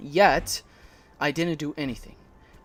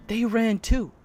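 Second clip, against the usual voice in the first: higher, louder retort; retold protest; 9 semitones higher, 7 dB louder than usual. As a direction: protest it indignantly, retelling. Retelling his protest to manager.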